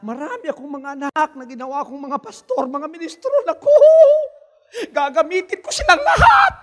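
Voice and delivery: gloating devil. Retold or imitated. Devil boasting to the preacher about his pastors, gloating laugh. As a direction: boast then cackle like the devil. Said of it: "Sinabi niya".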